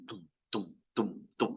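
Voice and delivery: soft, low "tum" pulses; imitated bass thumps. Shown as a demonstration. Teacher explaining the bass knob, low soft thumping sounds. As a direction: thud softly like bass from a speaker. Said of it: "you're adjusting the bass".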